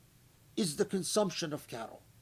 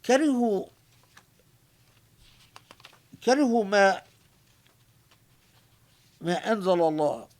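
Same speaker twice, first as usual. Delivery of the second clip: louder Quranic recitation; 5 dB louder, much faster than usual. Acted. Quoting Quranic phrase.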